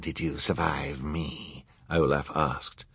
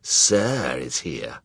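villain voice; 5 semitones lower, much slower than usual. A narrator's reading. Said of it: "Olaf retorted".

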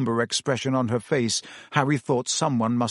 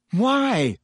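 chorused why; 9 semitones higher than usual. A narrator's reading. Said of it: "chorused the rest of the team".

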